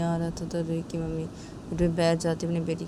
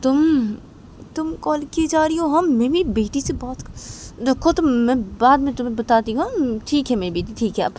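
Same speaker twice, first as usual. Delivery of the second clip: a touch higher, hurried and pressed; flustered mother; 7 semitones higher, 7 dB louder than usual. Acted. Mother on the phone, hurrying the girl off. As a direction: brush the caller off, distracted.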